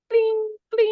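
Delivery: high imitated chime; ding like a dashboard light; warning chime. Friend imitating a warning light in the conscience, high chime.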